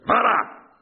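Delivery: sharp, high, loud warning; one high commanding yell; warning cry. A narrator's reading. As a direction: shout to hold them back. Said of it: "zawołał".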